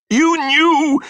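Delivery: high, loud accusing shout; furious shout. A narrator's reading. Mother turning on Missus Grummick, shouting. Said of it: "my mother shouted".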